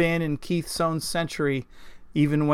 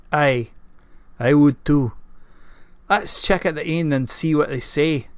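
burly Scottish knight's gruff voice; gruff Scotsman. A narrator's reading. Gawain asks Keith about tracking, gruff Scottish voice. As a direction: play a gruff Scotsman sounding out his companion. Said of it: "gruff voice that matched his exterior".

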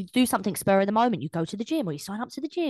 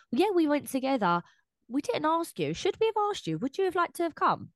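high, breezy mock excuse, much higher; mock casual excuse; 7 semitones higher than usual. Friend mimicking liars brushing off being left out, breezy and high. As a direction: toss off the excuse in a high, airy put-on voice. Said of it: "is like".

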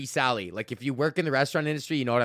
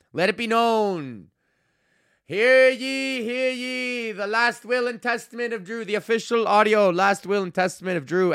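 town crier; 7 semitones higher, 4 dB louder than usual. Pretend. Mock proclamation of his will.

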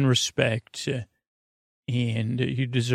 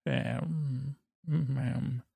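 cat talk; 12 dB quieter than usual. Pretend. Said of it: "I'm fluent in Calico".

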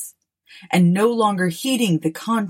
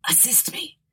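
dying whisper; 9 dB quieter than usual. Acted. Dying man's plea, read aloud.

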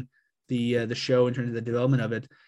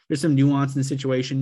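normal talking voice

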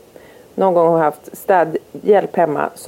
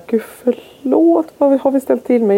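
high, slow apologetic gasp; mock horror at what they did; flustered cleaners; 7 semitones higher than usual. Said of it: "de bara". (stressat ursäktande, ljust och långsamt)

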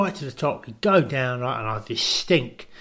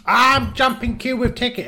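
much higher put-on voice, proud; mock Kim voice; 10 semitones higher, 4 dB louder than usual. A pal mimics Kim at the queue front, high and proud. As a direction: wave the ticket and crow it.

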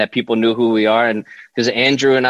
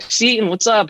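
lightly imitated bubbly greeting, higher pitched; Chrissy Teigen; 11 semitones higher than usual. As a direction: call out a friendly hello in a higher voice. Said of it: "She's like".

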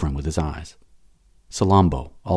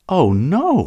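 pleading slave; 9 semitones higher, 3 dB louder than usual. Acted. Slave refuses to leave.

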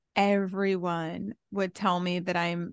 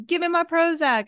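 mock partner; 8 semitones higher, 3 dB louder than usual. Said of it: "You're like".

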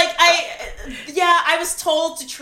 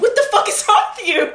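higher, fast, half-laughing indignation; mock outrage; 9 semitones higher, 4 dB louder, faster than usual. Actress retelling her reaction to being bitten, mock outraged.

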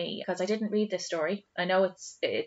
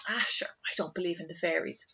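mock whisper; 2 semitones lower, 7 dB quieter than usual. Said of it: "a lot of people would be like".

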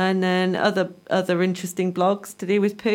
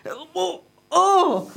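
shocked; 10 semitones higher than usual. Real, not acted. Shocked by gross image.

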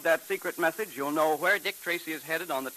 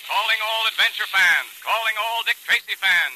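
loud, high booming announcer; radio announcer call-out; 7 semitones higher, 6 dB louder than usual. Acted. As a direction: call out to every listener. Closing announcement.

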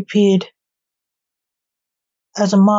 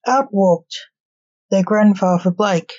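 usual speaking voice

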